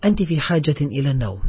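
ordinary delivery

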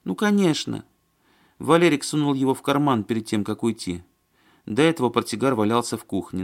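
high-voiced, insistent woman character; Маня. A narrator's reading. Woman recounting Валерик and his cigarette case, fretful.

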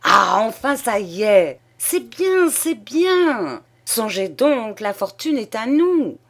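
high, loud, gleeful exclamations; overjoyed. A narrator's reading. Father-in-law congratulating the husband on the coming baby, overjoyed. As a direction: crow with delight at the windfall. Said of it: "Et dans sa joie".

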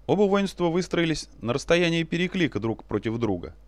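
usual speaking voice

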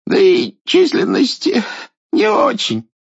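put-on high, frightened old king voice; a scared old king ventures a remark; timid old tsar. Царь робко замечает идолу. (робкий старый царь)

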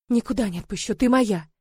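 ordinary delivery

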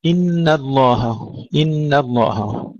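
إن الله drawn out, light nun and ha; slow tajweed recitation. Shown as a demonstration. Teacher reciting for students. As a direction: chant إن الله slowly. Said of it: "ترقيق النون والهاء".

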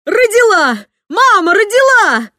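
high, loud ecstatic yelling; overjoyed father shouting the birth news. A narrator's reading. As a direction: shout the news like you can't contain it.

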